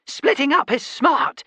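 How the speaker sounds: small, very high, urgent voice; squeaky high advice; tiny Calvin voice. Acted. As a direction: pipe up like a tiny pocket creature. Calvin's voice again.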